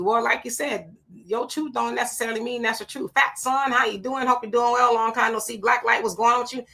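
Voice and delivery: ordinary talk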